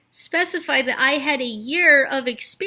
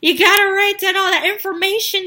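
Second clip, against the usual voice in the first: pleading managers; 6 semitones higher, 5 dB louder than usual. Retold or imitated. Managers begging Krista not to leave yet, mock plea. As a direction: mimic the bosses begging her to stay. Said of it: "they're just like".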